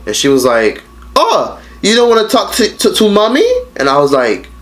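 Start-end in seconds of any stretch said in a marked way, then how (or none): 1.1-3.5 high, mocking baby-talk mom voice; guilt-trip in a fake sugary voice; mock sweet mom. Mom guilt-tripping her daughter, high and mock-sugary. Mother voiced in retold call.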